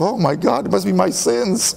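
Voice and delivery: "oh no" moment, played for a laugh; mock alarm. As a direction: retell a sudden guilty panic, lightly hammed-up. Alarmed inner exclamation, laughs after.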